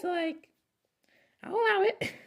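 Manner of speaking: very high kitty voice saying "Neko nyasu"; cat voice. Shown as a demonstration. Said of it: "cat voice".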